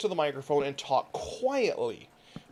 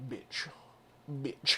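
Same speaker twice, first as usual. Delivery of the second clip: soft mock ASMR bitch; 5 semitones lower, 8 dB quieter than usual. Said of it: "They would just be going".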